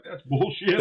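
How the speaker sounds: high-pitched mock outrage, laugh creeping in; half-laughing replay of being mad; retold mad outburst. Competitor rejecting his ex-wife's excuse, retold mad outburst. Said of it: "I was so mad".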